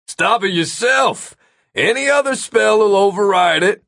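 high yell across a distance. A narrator's reading. Tyler answering Gary far off in the distance, yelled. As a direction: yell it back at him, high and forceful. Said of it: "Tyler yelled".

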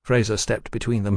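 plain reading voice

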